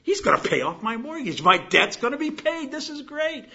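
high, quick, giddy disbelief; growing excitement. Acted. Homeowner watching a stranger pay off the mortgage, growing excitement. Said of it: "you get a little more excited".

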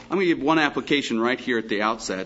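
ordinary talk

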